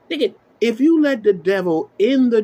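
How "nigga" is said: usual speaking voice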